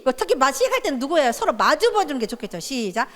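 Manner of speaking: ordinary talk, nothing marked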